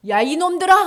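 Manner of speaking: high, loud scolding yell; angry shout. Retold or imitated. Imagined Jesus shouting at his tormentors, angry yell. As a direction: holler it as a rebuke. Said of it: "소리를 지르고 화를 내셔도".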